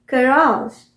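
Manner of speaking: कराज stressed on a long second syllable, "guh-RAAZH"; American pronunciation. Shown as a demonstration. Teacher quizzing learners on the variant, American pronunciation. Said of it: "American pronunciation".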